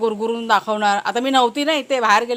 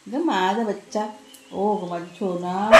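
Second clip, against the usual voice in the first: slow, soft cooing to a pet; 5 dB quieter, slower than usual. Real, not acted. Owner soothing her beloved dog Waghya, tender coo. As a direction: coo lovingly at your little one.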